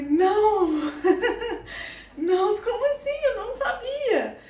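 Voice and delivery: loud, high, laughing cry of disbelief; shocked disbelief. Retold or imitated. Told by the doctor she is pregnant, a loud laughing shocked cry. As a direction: blurt it out in giddy shock, laughing.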